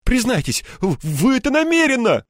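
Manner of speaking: high, loud stammered accusation; breathless indignation. A narrator's reading. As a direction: demand a confession, spluttering with outrage. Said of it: "в приливе возмущения ему трудно было дышать".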